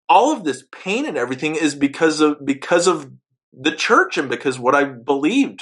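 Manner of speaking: building fury, pitch climbing line by line; rising anger. Retold or imitated. Man realizing he was misled, rising in anger. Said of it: "intense anger".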